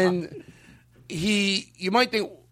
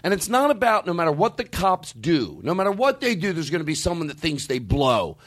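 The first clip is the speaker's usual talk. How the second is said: normal talking voice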